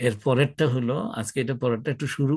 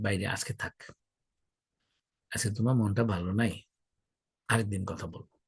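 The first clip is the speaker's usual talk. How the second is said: soft, lowered voice, gently putting off the talk; calm de-escalating reply; 2 semitones lower, 5 dB quieter than usual. Shown as a demonstration. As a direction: defuse it quietly. Models calm reply to shouting brother.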